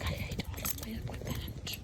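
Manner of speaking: very quiet voice for a dog's complaint; grumbling dog. Acted. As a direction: grumble softly as the dog would. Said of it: "He's like".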